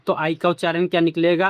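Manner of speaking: usual speaking voice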